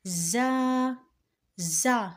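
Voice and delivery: زا with a strong buzzing z; hard pronunciation. Shown as a demonstration. Teacher modelling the letter for learners, زا with hard z. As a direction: press hard on the z of زا. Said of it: "سختی سے پڑھا جاتا ہے".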